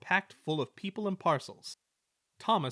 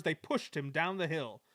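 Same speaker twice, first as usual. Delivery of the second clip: ordinary delivery, nothing marked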